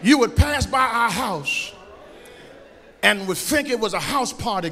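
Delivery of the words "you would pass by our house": preaching build. Acted. Pastor telling the church about discipline, preaching. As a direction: build the point to the crowd.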